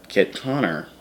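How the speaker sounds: usual speaking voice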